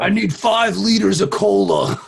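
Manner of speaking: loud, high movie-character impression; Farva. Quoting a film after hearing liters, loud slow impression. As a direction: drag it out in a demanding mock shout. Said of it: "thinking of Farva from Super Troopers".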